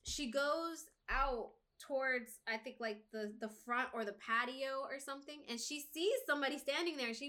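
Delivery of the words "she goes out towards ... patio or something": ordinary talk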